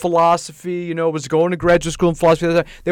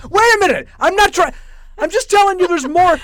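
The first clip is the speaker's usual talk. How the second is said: much higher and louder, pleading to be heard; exasperated protest; 8 dB louder than usual. Retold or imitated. To the bar patrons, a high exasperated protest. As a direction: push back, frustrated and urgent.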